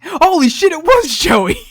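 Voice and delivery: loud, high burst of delighted disbelief; excited shout. Real, not acted. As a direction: yell it out, cracking up. Co-host cracks up at the impression.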